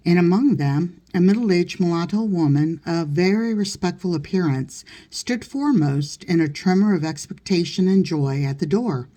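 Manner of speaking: ordinary delivery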